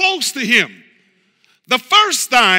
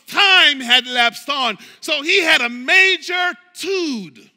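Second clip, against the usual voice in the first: loud, driving sermon cadence; preaching at full force; 3 semitones higher, 4 dB louder than usual. Acted.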